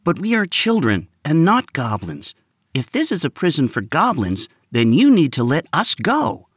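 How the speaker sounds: put-on boy confidently answering the guard. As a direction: stand tall and say it with confidence. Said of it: "said confidently".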